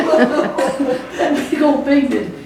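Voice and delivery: laughing at the irony. Real, not acted. Teacher to interviewer, laughing about the scared giant. Big player scared of kids.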